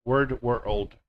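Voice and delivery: normal talking voice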